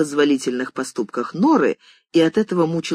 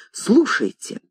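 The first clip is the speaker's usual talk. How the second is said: furious cry; 8 semitones higher, slower than usual. A narrator's reading. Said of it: "крикнула она вне себя от ярости".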